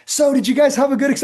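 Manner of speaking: high, loud, cheerful question; friendly instructor. Retold or imitated. Former captor asking graduates about their experience, cheery. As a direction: sound suddenly friendly and casual. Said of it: "they're like".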